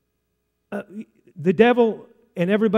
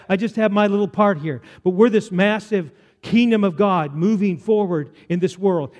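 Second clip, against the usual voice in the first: ordinary delivery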